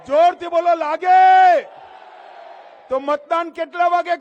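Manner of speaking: high, full-force shouted questions; loud demagogue's prompting; rally call-and-response. Real, not acted. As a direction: shout it so the crowd answers back.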